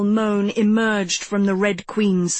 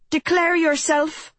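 cried out; 7 semitones higher than usual. A narrator's reading. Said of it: "Emily cried".

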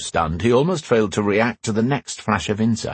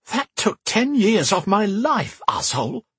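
swearing; 12 semitones higher than usual. Acted. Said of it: "Karen swore".

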